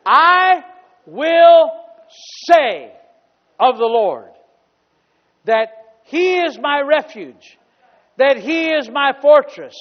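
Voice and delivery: loud, drawn-out scripture proclamation; booming pulpit reading; preaching at full force. Acted. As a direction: proclaim every word like a trumpet. Preaching the psalm.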